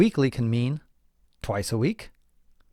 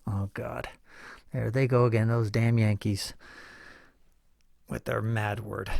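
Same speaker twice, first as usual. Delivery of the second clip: mock British grumble; 3 semitones lower, 6 dB quieter than usual. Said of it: "British people are always scratching their heads".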